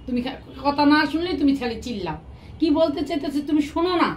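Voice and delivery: usual speaking voice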